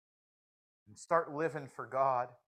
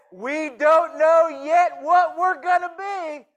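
preaching shout; 13 semitones higher, 13 dB louder, slower than usual. Real, not acted. Preacher shouting scripture point.

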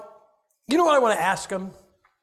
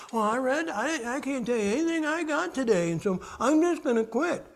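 high-pitched mock complaint; whining quitter; 6 semitones higher than usual. Preacher mimicking a discouraged Bible reader, high mock whine. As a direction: imitate someone giving up in a higher voice.

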